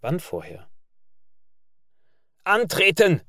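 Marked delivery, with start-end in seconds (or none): 2.4-3.2 high, loud, rasping croaked order; angry croak. A narrator's reading. Sergeant croaking angrily at the unheeding boys, rasping shout. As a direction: croak it out in anger. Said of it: "krächzt der Feldwebel böse".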